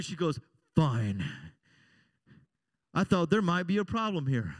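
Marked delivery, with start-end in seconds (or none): 0.8-1.2 dropped very low and loud; one heavy, ominous word; loaded "fine". Retold or imitated. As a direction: imitate a wife who is clearly not fine. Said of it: "was depending on how bad it was".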